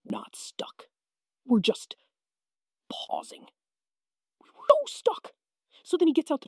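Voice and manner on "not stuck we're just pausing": whispered mock-calm dad; dad in denial. Kid imitating dad whispering denial in the stuck van. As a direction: breathe it out pretending all is fine. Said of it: "and goes".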